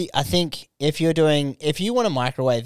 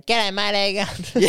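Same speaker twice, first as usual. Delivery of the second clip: high, broad Aussie G'day; hammed-up broad Aussie greeting; broad Aussie accent; 7 semitones higher, slower than usual. Ordering at drive-through in a high broad Aussie voice. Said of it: "like a Jacques Barrett joke".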